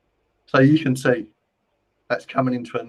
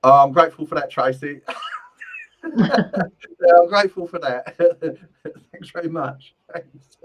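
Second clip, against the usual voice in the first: higher, louder, breaking into laughter; laughing thanks; 4 semitones higher, 7 dB louder than usual. Real, not acted. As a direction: say thanks through real laughter. Amused thanks to Tracy through laughter.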